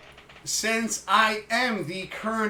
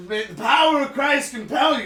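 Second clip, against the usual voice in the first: mock exorcist; 5 semitones higher, 7 dB louder than usual. Mock exorcism after demonic voice.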